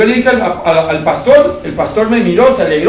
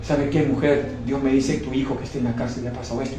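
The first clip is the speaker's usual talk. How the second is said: softer, lower confiding voice; hushed prophecy; 5 semitones lower, 12 dB quieter than usual. Retold or imitated. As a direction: tell her the secret gently under your breath. Voicing his own prophetic words. (profecía dicha en voz baja y confidencial)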